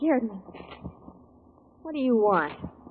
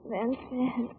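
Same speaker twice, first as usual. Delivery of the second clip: softer, drifting, barely holding on; weak and fading; 4 dB quieter, slower than usual. Acted. Wounded secretary fading as detective presses for name. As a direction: answer while slipping away. Shot woman fading, near fainting.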